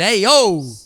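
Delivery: mock Italian-American interjection; put-on New York Italian cry; Italian-American accent. Shown as a demonstration. [name] shows off his Italian with an Italian-American exclamation. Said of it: "Now I can do the Italian".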